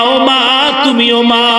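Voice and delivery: ordinary delivery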